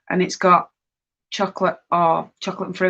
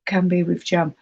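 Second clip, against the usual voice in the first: normal talking voice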